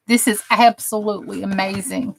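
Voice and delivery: ordinary talk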